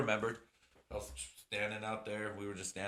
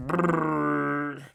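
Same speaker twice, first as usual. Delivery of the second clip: loud shiver brr; 5 semitones higher, 12 dB louder than usual. Retold or imitated. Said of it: "I'm always like".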